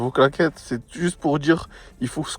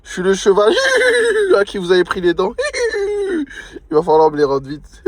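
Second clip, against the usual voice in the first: laughing horse voice; 14 semitones higher, 4 dB louder, faster than usual. Acted. Horse character call, laughing.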